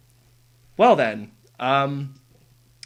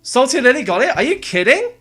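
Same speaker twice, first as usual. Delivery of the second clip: incredulous, much higher voice, rising questions; stunned, high-pitched questioning; real astonishment; 9 semitones higher than usual. Real, not acted. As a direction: voice leaps up in sheer disbelief at the news. Stunned by news of others' success.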